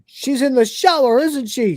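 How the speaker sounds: high-pitched mock accusation; jealous accusation. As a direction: accuse in a whiny, hurt voice. Acting out Crichton's jealous accusation.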